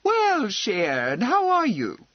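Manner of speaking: put-on lady hailing an old landlord warmly; friendly greeting. A narrator's reading. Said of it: "saying in her friendly way".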